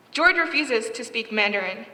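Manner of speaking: ordinary delivery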